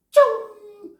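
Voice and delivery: high squeaky yelp of a fleeing roach; mock squeal. Roaches scattering, mock scream.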